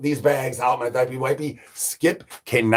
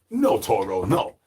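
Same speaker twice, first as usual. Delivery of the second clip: ordinary delivery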